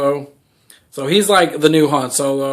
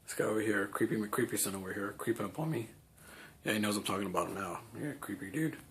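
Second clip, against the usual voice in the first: much softer, lower, conspiratorial murmur; hushed aside; 6 semitones lower, 20 dB quieter, faster than usual. Pretend. Pretending Kylo Ren lurks nearby, a hushed wary murmur. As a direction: drop to a near-whisper as if he can hear you.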